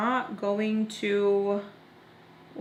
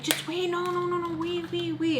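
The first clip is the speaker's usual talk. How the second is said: high-pitched whiny pleading; whining; 9 semitones higher than usual. Retold or imitated. Friend begging the driver to just wait, whining. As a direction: imitate her whining, much higher. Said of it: "she's, like, literally whining".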